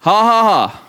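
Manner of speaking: deliberate mock laugh, syllable by syllable; demonstrated spoken laugh. Shown as a demonstration. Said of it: "Sometimes you just have to say".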